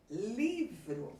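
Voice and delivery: livro, LI stretched and stressed, vro weaker. Shown as a demonstration. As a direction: punch the first syllable of livro. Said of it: "a sílaba mais forte é a sílaba li".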